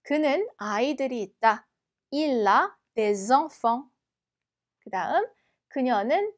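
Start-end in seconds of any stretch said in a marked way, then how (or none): 2.1-3.9 slow, careful French model sentence; slow teacher's French read-aloud. Shown as a demonstration. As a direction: read it slowly like a language tape.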